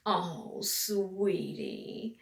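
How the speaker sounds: soft, patronizing pity; condescending sweetie. Acted. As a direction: talk down to her gently. Condescending reply to sister.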